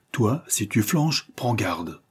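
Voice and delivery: ordinary delivery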